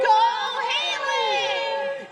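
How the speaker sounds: big drawn-out cheer, high and loud; cheering shout. Real, not acted. Reporter leads a stretched, high cheer for Haley. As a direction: yell it for the graduate like a fan.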